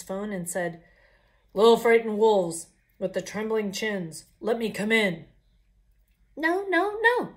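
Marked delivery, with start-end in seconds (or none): 1.5-5.3 loud, gruff demand at the door; bully your way in; the pig calling loudly at the door; big bad pig voice. 6.4-7.3 much higher squeaky refusal; little wolves' voice. Acted.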